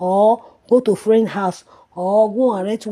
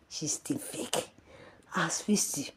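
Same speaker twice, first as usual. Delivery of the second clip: slow, hushed whisper of contempt; scornful whisper; 3 semitones lower, 12 dB quieter, slower than usual. Real, not acted. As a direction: hiss it like a secret insult. Contemptuous aside.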